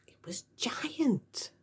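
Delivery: hushed, slow whisper; awed whisper. A narrator's reading. Narrator whispering the giant pig's size, slow. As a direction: breathe it out in awe.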